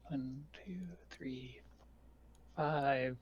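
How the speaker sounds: barely voiced whispered count; hushed, absent-minded counting; counting under the breath. Real, not acted. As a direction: whisper it to yourself as you count.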